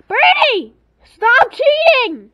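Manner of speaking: loud call-out. Real, not acted. Calling out the other player.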